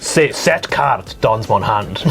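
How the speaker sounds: low, slow mock French; French accent. Telling friends which card goes to hand, slow French accent. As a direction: mix French and English in a put-on French accent. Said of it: "Still French".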